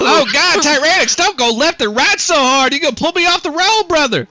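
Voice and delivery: high, urgent country driver; alarmed truck driver. Acted. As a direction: holler a frantic warning over the comms. Driver hollers at Tyrannix.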